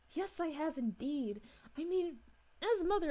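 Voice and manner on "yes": ordinary delivery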